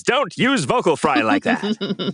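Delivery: put-on loud, high, slow lecture to kids; mock PSA voice. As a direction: preach it like a school warning. Mock public-service lesson.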